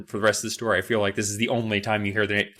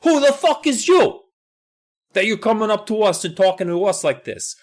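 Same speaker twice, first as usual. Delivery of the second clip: tough youths; 9 semitones higher than usual. Retold or imitated. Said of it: "the youths are just like".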